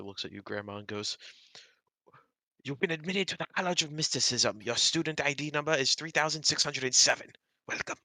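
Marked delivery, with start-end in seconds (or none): 2.6-8.0 formal headmaster, pitched higher. Acted. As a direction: announce it like a stately headmaster. GM voices headmaster to player.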